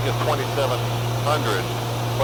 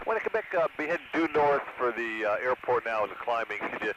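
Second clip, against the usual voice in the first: pilot radio call, quieter; over the radio; 9 dB quieter than usual. Real, not acted. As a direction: speak into the aircraft radio.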